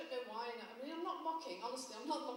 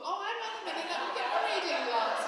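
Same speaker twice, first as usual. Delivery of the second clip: higher and loud, fussing over glasses; old dear voice; 7 semitones higher, 11 dB louder than usual. Retold or imitated. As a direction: potter about like a muddled pensioner.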